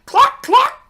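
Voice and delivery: high loud clucking hen. A narrator's reading.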